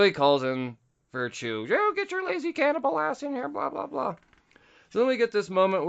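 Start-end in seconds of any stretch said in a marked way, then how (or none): 1.7-4.2 a much higher put-on boss voice, barking for Chu; bossy shout-in. Boss summoning Chu to his office, high mock bark. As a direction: call the lazy detective in like a squeaky, pushy boss.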